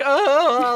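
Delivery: high loud whiny "ah"; mock whiny cry. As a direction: whimper like a helpless hobbit. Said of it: "he was always just like".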